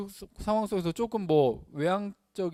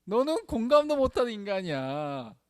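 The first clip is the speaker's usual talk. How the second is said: higher-pitched sneering accusation; mocking sarcasm; 5 semitones higher than usual. Preacher imitating those who taunt thinking types, mocking sarcasm. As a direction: mimic a taunt, dripping with mockery. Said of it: "조롱 섞인 그 비꼬미 사-, 섞여 있다고".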